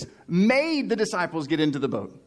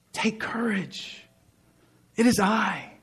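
gentle whisper; 4 semitones higher, slower than usual. Acted. Jesus calming terrified disciples on the lake, gentle whisper. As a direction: breathe it to calm them. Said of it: "Jesus said to them".

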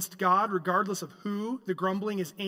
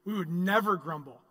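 usual speaking voice

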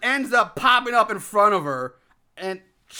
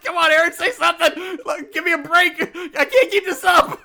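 higher and louder, half laughing; pleading inner voice; 9 semitones higher, 4 dB louder than usual. Retold or imitated. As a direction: beg him to say something, strained and giggling.

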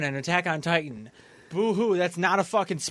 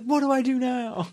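higher, panicked little question; mock panic; 8 semitones higher than usual. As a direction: play the scared gamer, high-pitched. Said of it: "You're like".

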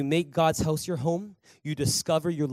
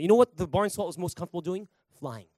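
usual speaking voice

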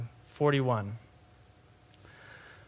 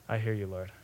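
low, very soft acceptance; humbled; 8 semitones lower, 8 dB quieter than usual. Acted. Believer answering God's rebuke, humbly quiet. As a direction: give in quietly to the correction.

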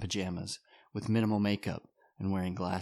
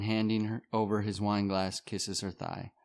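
usual speaking voice

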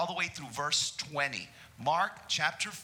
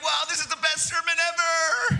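much higher, loud mock-gushing shout; mock amazement; 14 semitones higher, 8 dB louder than usual. Congregation mocked as amazed by the sermon, shouted. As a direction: ham up the crowd's awe. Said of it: "Some of you are like".

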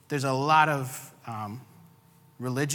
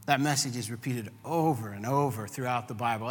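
ordinary talk

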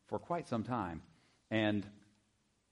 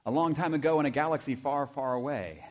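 louder, higher movie trailer voice; Star Wars opening; 4 semitones higher, 5 dB louder than usual. Retold or imitated. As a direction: epic movie-intro announcer. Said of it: "You know that beginning".

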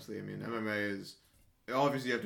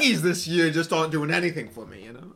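loud, high Boston accent put on; hammy mock Boston accent; 5 semitones higher, 10 dB louder than usual. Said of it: "Really put on the Boston accent".

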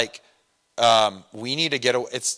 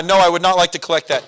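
loud refusal; 5 semitones higher, 8 dB louder, faster than usual. Retold or imitated. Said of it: "acted refusal to collect call".

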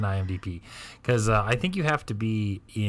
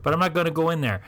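much higher Wisconsin voice, puzzled; a high, nasal-free Midwest grandma asking; Midwest accent; 7 semitones higher than usual. Retold or imitated. Grandparent asking what carne asada is, high Midwest accent. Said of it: "the Midwest accent is real".

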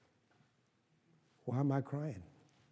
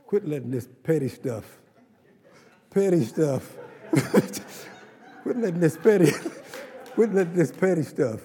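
loud, high and laughing through the repeats; rising laughing preacher's shout; laughing exhortation; 4 semitones higher, 7 dB louder than usual. Real, not acted. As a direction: preach it louder each time, breaking into laughter. Preacher builds, laughing mid-phrase.

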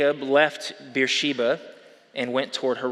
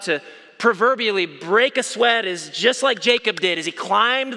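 hard sell ad; 5 semitones higher than usual. Pretend. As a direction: sell it like a TV pitchman. Infomercial parody pitch.

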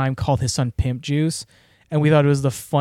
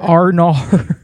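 louder mock New Zealand "oh no"; botched accent; 3 semitones higher, 7 dB louder than usual. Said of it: "is this a Southern accent?".

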